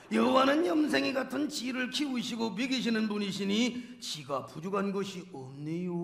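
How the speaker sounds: put-on psalm in a Chungcheong accent, dropping at the close; Chungcheong dialect. Preacher performs the Chungcheong version for laughs, Chungcheong dialect. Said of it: "충청도 버전".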